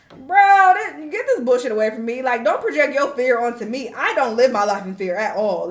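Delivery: loud opening burst, then firm pushback; indignant retort. Retold or imitated. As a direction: push back hard, loud and fed up. Retorting to worried message senders.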